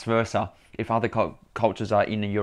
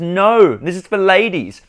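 loud high-pitched exclamation; high, drawn-out shout of refusal; retold refusal; 10 semitones higher, 11 dB louder, slower than usual. Shopkeeper refusing a man a women's jacket, loud indignant refusal. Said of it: "She goes".

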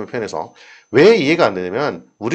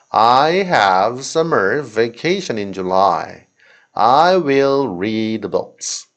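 slow model reading; much slower than usual. Shown as a demonstration. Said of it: "하나씩 해석해 볼까요".

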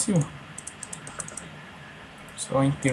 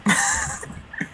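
laughing. Real, not acted. Laughs at friend's remark.

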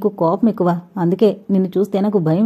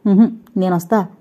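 a touch of fluster. A narrator's reading. Purna wants to come along, mildly flustered. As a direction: blurt it with mild alarm. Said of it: "పూర్ణ కంగారుగా అంది".

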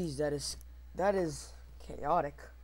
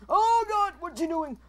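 loud, high-pitched alarm; panicked yell; 12 semitones higher, 12 dB louder than usual. Real, not acted. Player suddenly attacked in a game, real panicked yell.

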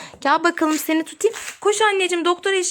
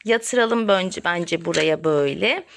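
low doctor voice; 12 semitones lower than usual. Pretend. Mother plays doctor, low voice.

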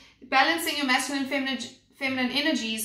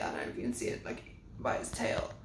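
whisper; 12 semitones lower, 12 dB quieter, much faster than usual. Real, not acted. Pointing out a detail on the card.

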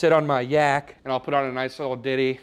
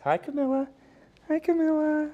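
very high squeaky voice for the dog; high pet voice saying hi to Camilla; 14 semitones higher than usual. Pretend. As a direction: speak for the dog to the little girl. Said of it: "Lucy says hi".

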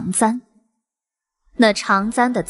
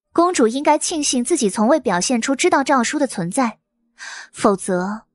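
mocking; 4 semitones higher than usual. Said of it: "面带嘲讽".

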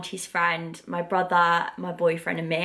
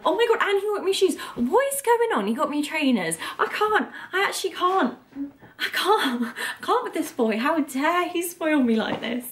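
much higher, squealing with delight over the gifts; giddy excitement; 8 semitones higher than usual. Real, not acted. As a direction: gush like you can't believe your luck. Excited unboxing of boyfriend's gifts.

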